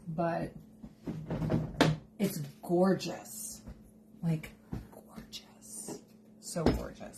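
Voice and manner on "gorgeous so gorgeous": hushed, drawn-out whisper; whispered gush. Real, not acted. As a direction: whisper it in awe. Whispered gush over the dish.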